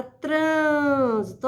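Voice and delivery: "trânsito" with a long, strong "trân"; stress on antepenultimate syllable. Shown as a demonstration. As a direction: hold the first syllable of "trânsito". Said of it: "Sílaba tônica". ("trânsito" com "trân" forte e alongado)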